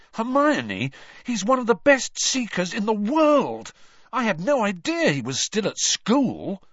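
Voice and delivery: high, loud eager insistence; excited. A narrator's reading. Ron telling Hermione how great Krum is, excited. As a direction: convince her he is a star.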